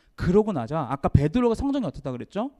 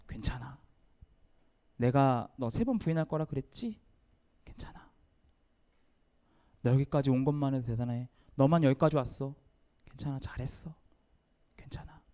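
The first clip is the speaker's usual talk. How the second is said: softer, lower, gentle comfort; tender reassurance; 5 semitones lower, 6 dB quieter than usual. Retold or imitated. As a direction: comfort a crushed friend gently. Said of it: "그런 부드러운 사랑스러운 눈빛". (부드럽고 낮게 위로하는 예수님)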